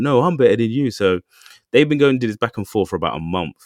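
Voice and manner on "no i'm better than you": higher, louder mock one-upping; louder comeback boast. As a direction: fire it back, topping him. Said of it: "And they're like".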